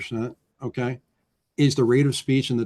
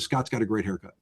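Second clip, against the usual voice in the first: HAIRCUT softly lowered at the end; falling command tone; 3 semitones lower, 7 dB quieter than usual. Shown as a demonstration. Speaker showing how statements validate, soft falling end on HAIRCUT. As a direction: say it as settled fact, sinking through HAIRCUT. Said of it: "If I lower my voice at the end".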